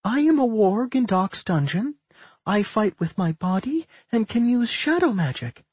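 put-on high-pitched girl monster introducing herself; high, earnest creature-girl voice; warg twin girl. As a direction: recite it in a high, girlish voice.

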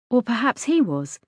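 ordinary delivery

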